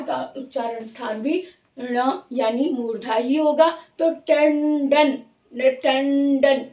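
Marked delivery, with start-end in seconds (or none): 4.0-6.6 टंडन said slowly, the nasal before ड sounded from the roof of the mouth; drawn-out टंडन showing the retroflex nasal; slow demo pronunciation. Shown as a demonstration. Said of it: "इस तरह से मूर्धा sound को लेते हुए".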